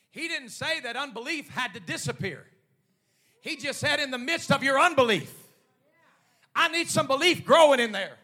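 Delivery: high, shouted pulpit preaching; fervent, sustained preacher's shout; full-force preaching. Real, not acted. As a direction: drive each phrase home at the top of your voice.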